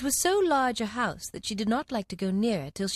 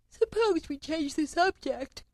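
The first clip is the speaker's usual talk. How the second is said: yawning; 8 semitones higher, slower than usual. A narrator's reading.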